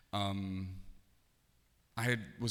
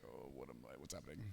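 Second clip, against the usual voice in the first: anxious hushed thought; 8 semitones lower, 16 dB quieter, faster than usual. Retold or imitated. Nervous thought, called to office.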